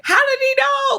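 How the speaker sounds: high, amazed question; stunned disbelief. Retold or imitated. Lisa shocked the elder singled her out, high and amazed. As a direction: ask it wide-eyed in shock. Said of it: "Lisa's like".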